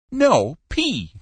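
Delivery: high, forceful correction; exasperated correction. A narrator's reading. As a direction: correct the listener, losing patience. Caller corrects the misheard letter.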